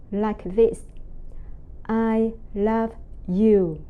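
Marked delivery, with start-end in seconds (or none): 1.9-3.8 slow, level words without any emotion. Shown as a demonstration. Teacher illustrating the first tone, slow and emotionless. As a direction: say it slowly on one flat tone, emotionless. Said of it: "say something without any emotion".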